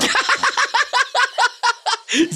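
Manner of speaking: long high sneering cackle; evil laugh. Acted. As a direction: laugh like a sly villain. Villain laughs after Lugo agrees.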